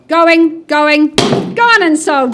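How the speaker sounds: booming going going gone; loud hammer call. Real, not acted. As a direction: call the sale out big and loud. Auctioneer's final hammer call.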